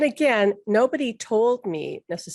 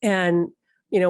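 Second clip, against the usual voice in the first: ordinary delivery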